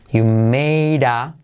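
slowly, word by word; teacher's slow model; slow demo. Shown as a demonstration. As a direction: spell out the linking slowly. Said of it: "fijaos como made a".

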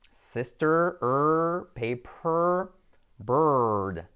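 drawn-out "er" in "Sister", "paper", "bird"; shared R sound. Shown as a demonstration. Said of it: "simplemente es como sister".

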